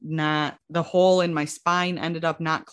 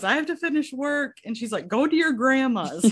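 high, upset mom voice; 9 semitones higher than usual. Retold or imitated. Mom at work telling crying daughter to go to grandma's, high and upset. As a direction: play a flustered mother sending her daughter away.

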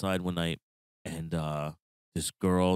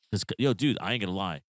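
ordinary delivery

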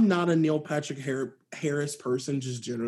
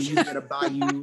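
mock Creole accent. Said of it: "full Creole".

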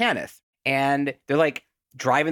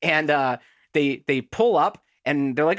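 normal talking voice

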